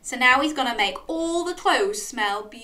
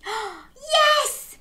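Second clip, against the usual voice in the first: high, excited yes; delighted cheer; 3 dB louder than usual. Real, not acted. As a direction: cheer after a gasp. Cheers Dog's decision.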